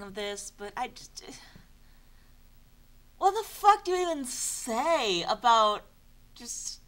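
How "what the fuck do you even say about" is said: exasperated. Real, not acted. Exasperated at the text.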